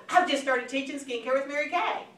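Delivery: keen announcement to a friend; enthusiastic. Shown as a demonstration. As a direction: share it proudly as exciting news. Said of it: "the first one's enthusiasm".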